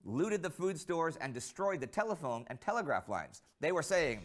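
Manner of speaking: ordinary talk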